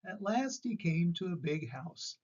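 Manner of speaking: ordinary delivery, nothing marked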